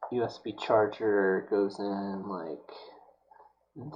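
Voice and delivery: breathy whisper to himself; slow, hushed whisper over the work. Real, not acted. Muttering to himself while working.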